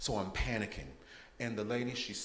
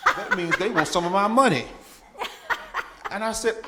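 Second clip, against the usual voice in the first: laughing; 10 semitones higher, 12 dB louder than usual. Real, not acted. Laughing at debt letters.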